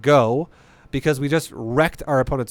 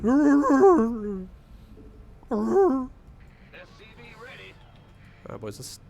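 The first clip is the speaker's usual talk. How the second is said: walrus voice; 9 semitones higher, much slower than usual. Pretend. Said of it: "Do the mentally challenged walrus noise".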